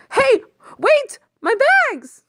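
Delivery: very high, loud panicked cry; shouting after a thief, panicked; desperate shout. Acted. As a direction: yell after the speeding car. Calling after the fleeing driver.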